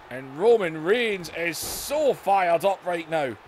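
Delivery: excited ringside commentator calling the action; hyped pro wrestling commentary, bursts of shouting on big moves; wrestling play-by-play. Acted. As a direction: call every move like a live TV announcer. Live wrestling game play-by-play.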